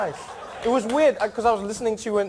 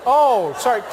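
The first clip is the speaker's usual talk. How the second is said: ordinary talk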